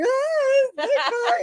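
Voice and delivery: hammed-up fright at seeing Bigfoot; a pretend shriek of panic, high and crying; mock scream. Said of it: "I wouldn't be like".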